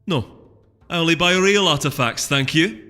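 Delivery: dismissive. A narrator's reading. Cranberry snubbing the vendor, dismissive. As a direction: sniff at the cheap wares. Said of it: "dismissive look and sniffed".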